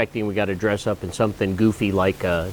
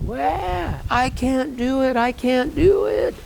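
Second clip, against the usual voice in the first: put-on much higher, slow whiny child sob; imitating a crying child; mock crying kid; 13 semitones higher, slower than usual. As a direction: play a bawling little boy, hammed-up. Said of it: "He starts crying".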